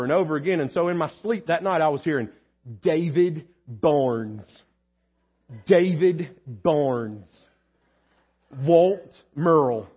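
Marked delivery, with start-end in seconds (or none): 2.8-9.9 very slow, lower, drawn-out name reading; Stanley Parkinson. Retold or imitated. Committee member reading off vote tallies, imitated slow drone. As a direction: intone each name ponderously, with long gaps. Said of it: "He has a very unique voice".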